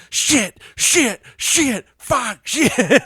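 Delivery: high loud string of curses; cursing frustration. Retold or imitated. Amazon driver cursing over and over, mock yell. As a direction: swear over and over, fed up. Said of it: "you hear him just going".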